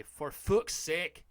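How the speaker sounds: acted loud exasperation; audible exasperated outburst. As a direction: let the curse burst out slowly and loudly. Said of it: "didn't mean to audibly say".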